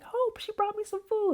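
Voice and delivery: high, soft-edged imitated delight; excited sharing. Woman telling Hope about the food, high and delighted.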